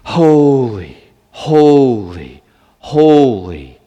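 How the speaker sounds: loud ringing cry of holy, holy, holy; angels' proclamation. Retold or imitated. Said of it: "saying back and forth to one another".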